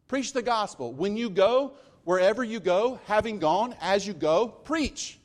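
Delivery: high, loud, building preacher's cry; full-force preaching. Real, not acted. Pastor urging the congregation to preach, rising and loud. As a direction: lift it up like a revival call.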